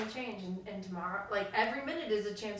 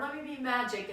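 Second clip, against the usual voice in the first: normal talking voice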